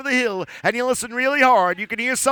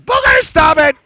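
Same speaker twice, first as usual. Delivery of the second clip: loud playful shout; mock scolding; 6 semitones higher, 9 dB louder than usual. Storyteller scolding the puppet again, loud mock shout. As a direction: bark it out teasingly.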